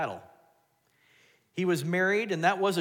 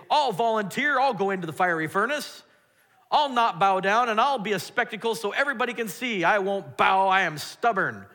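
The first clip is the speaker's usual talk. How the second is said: loud, higher, bold and stubborn; defiant volunteer; 7 semitones higher, 8 dB louder than usual. Retold or imitated. Believer volunteering for the furnace, loud stubborn boast. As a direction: proclaim it like a proud martyr. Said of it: "How many of you would like to say".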